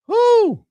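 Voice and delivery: high, loud, sudden woo; excited whoop. Real, not acted. Host cheering himself after a rambling rant, loud whoop. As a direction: let out a big celebratory whoop.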